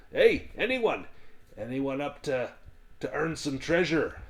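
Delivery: high, loud hearty dwarf calling out; rally the tavern like a gruff showman; boisterous dwarf recruiter. Acted. Dwarf calling to the inn for brave souls, high and loud.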